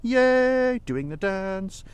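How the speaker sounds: ordinary delivery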